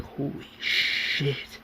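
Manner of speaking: hushed, drawn-out disbelief; utter shock. Acted. Roland sees blood on the infirmary bed, stunned. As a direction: let it out slowly under the breath, stunned. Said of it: "in complete and utter shock".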